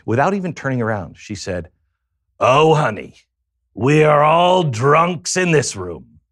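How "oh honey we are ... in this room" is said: put-on loud, high, drawn-out old-lady voice; Selma Diamond. Old woman at AA setting the newcomer straight, loud slow character voice. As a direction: drawl it out loud and high like a knowing old dame. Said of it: "That woman sounded like Selma Diamond".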